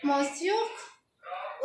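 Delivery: मॉस्योक stretched slowly, ending on a rise; softly stretched-out मॉस्योक; slow pronunciation demo. Shown as a demonstration. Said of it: "क्या बोलेंगे?".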